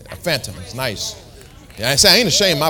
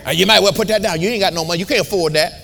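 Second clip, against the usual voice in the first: discouraging naysayer; 3 semitones higher, 3 dB louder than usual. Retold or imitated. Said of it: "How many heard people talk like that".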